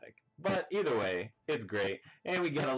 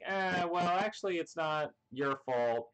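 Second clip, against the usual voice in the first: higher voice for the reply; 8 semitones higher than usual. Retold or imitated. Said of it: "And she's like".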